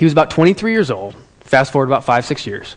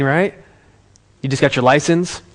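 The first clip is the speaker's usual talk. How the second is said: usual speaking voice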